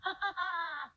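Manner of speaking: high breathless laughing fit; uncontrollable laughter. Acted. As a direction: laugh until you can't stop. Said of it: "Can't stop laughing".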